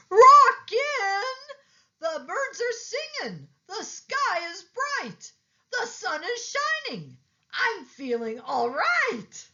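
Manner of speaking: very high, loud squeal of joy; ecstatic alligator. A narrator's reading. Alligator overjoyed with the sunglasses, very high and loud. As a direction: shriek with delight at the bright world.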